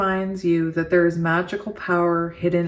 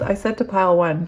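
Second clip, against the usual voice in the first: ordinary delivery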